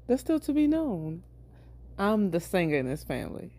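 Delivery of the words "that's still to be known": said again pitched up; higher retake; repeated higher. Shown as a demonstration.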